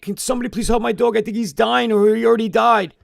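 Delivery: high, pleading panic for help; retold desperate cry for help at the vet counter; 1 frantic plea. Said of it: "And I'm like".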